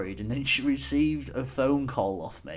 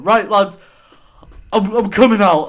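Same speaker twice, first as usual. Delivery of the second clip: put-on louder, higher drunk announcement; rally the lads, sloshed and bold; drunken rallying call; 8 semitones higher, 12 dB louder than usual. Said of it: "And I just remember".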